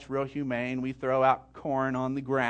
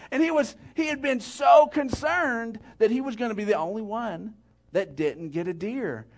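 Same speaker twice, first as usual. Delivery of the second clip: ordinary talk, nothing marked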